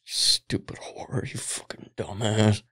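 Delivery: whisper. Retold or imitated. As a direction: whisper it with contempt. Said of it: "he's like".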